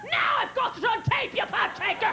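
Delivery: high-pitched mock-furious yelling; hammed-up angry phone rant; screaming. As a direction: imitate an outraged dad screaming. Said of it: "He calls me screaming".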